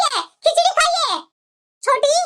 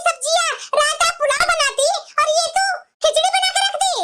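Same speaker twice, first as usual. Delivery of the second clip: put-on higher, nagging old-woman voice; scolding mother-in-law; 4 semitones higher than usual. Mother-in-law complaining to her daughters-in-law about late food, nagging. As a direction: grumble like a bossy saas.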